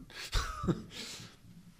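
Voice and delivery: short breathy laugh; embarrassed laugh. Real, not acted. Preacher forgetting a name, embarrassed chuckle. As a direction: laugh it off briefly, mostly breath.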